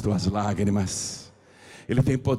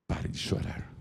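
breathy, voiceless hush; whisper; 10 dB quieter than usual. Acted. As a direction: lean in and whisper it close. Soft repeat of Jesus' words. (sussurro suave)

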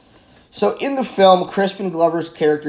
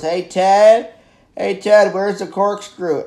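raised calling voice, higher; retold call, louder and higher; 4 semitones higher, 6 dB louder, slower than usual. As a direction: call across the room to a friend. Calling to friend in film.

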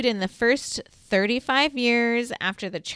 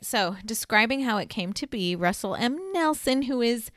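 normal talking voice

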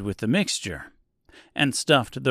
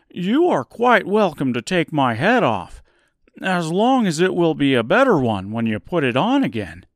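higher, louder scarecrow voice; eager straw man, higher pitched; creaky scarecrow; 5 semitones higher, 5 dB louder than usual. Acted. Scarecrow speaks to Oz.

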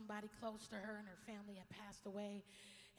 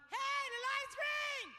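loud, high, drawn-out yell out a car window; 14 semitones higher, 13 dB louder, slower than usual. Retold or imitated. Lady yelling at the man at the green light. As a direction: holler it at the driver ahead. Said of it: "She yelled out".

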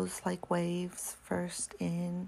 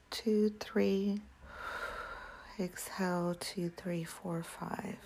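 slow counted breathing cues; counting breaths; 2 semitones higher, slower than usual. Shown as a demonstration. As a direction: count each breath, letting the exhale fade. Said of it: "Let's repeat that a couple more times".

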